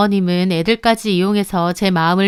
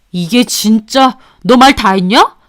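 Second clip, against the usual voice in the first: louder husband lashing out; angry husband; 5 dB louder, slower than usual. Acted. Husband turns on the mistress, angry. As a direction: threaten her back.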